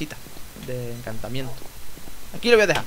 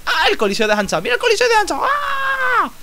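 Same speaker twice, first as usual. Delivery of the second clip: piercing falsetto squeal, climbing higher; mock scream; 4 dB louder than usual. Player repeating a bit about the Coliseo, mock high scream. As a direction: squeal it out in an over-the-top high voice.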